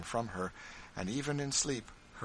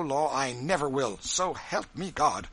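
raised, emphatic vow; emphatic refusal; 5 semitones higher, 6 dB louder than usual. Acted. Wife arguing with her husband, emphatic refusal. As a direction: swear it firmly, higher and louder.

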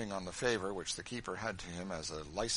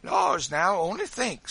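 high, loud jeer; boisterous teasing; mock amazement; 10 semitones higher, 13 dB louder, slower than usual. A narrator's reading. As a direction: exclaim in loud mock wonder. Sambo jeers at Adolph.

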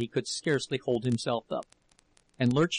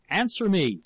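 higher, louder furious demand; shout; 6 semitones higher, 4 dB louder than usual. A narrator's reading. Husband striking the table at wife, furious shout. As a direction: shout the order at them. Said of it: "and shouted again at her".

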